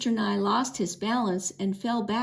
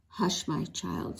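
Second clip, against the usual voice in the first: soft scolding; 3 semitones lower, 5 dB quieter, slower than usual. A narrator's reading. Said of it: "scolded Missus Merriman".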